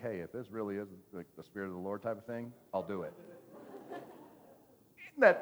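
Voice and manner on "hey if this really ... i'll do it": soft, hushed retelling of his whisper; imitating a friend's hushed whisper; mock whisper. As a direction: drop to a secretive murmur. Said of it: "he was whispering".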